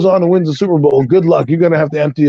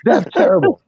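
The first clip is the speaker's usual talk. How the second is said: much higher, squeaked That's terrible; high mock disgust; 13 semitones higher than usual. Real, not acted.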